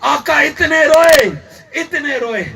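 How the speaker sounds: forceful sermon storytelling; impassioned preaching. Acted. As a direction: preach it out line by line. Preacher retells at full force.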